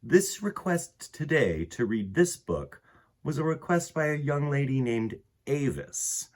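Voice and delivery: ordinary delivery, nothing marked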